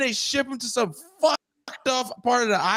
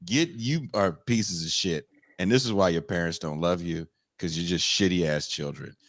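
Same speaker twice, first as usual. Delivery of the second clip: much lower, stern mock scolding voice; the brown people throw the rude kids out, much lower stern scold; scolding host parent; 13 semitones lower than usual. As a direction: drop way down and tell the brats off. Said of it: "the brown people are like".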